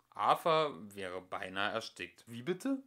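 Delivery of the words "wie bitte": much higher stunned question; nearly choking on surprise; stotternd, fassungslos und hoch; stammered shock. A narrator's reading. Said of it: "stotterte er".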